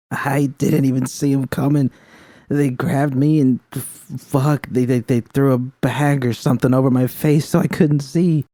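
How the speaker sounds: high, stammering, tearful street woman; rattled and hurting, pushing through; shaken young woman. Acted.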